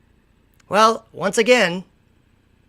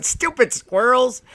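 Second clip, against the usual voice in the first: higher, slow gloating Stupid squirrels; mock gloat; 6 semitones higher, slower than usual. Walking away from squirrels, slow higher gloat. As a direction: gloat it slowly, a bit higher. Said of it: "walk away laughing, going".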